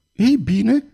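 a high, tearful outburst; exclaiming. A narrator's reading. Young duke bursts out to Pardaion, high tearful exclamation. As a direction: burst out, near tears. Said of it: "exclamă tânărul duce".

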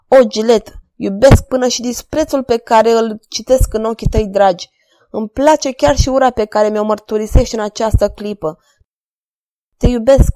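loud, fervent declaration of love; ardent, delirious. A narrator's reading. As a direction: pour it out like a man possessed. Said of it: "continuă înflăcărat, delirant". (declarație înflăcărată, delirantă)

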